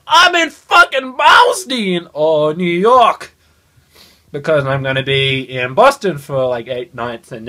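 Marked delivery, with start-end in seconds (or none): 0.1-3.3 high, slow shouted tourist brag; hammed-up excited yell; mock excited shout. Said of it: "to be like".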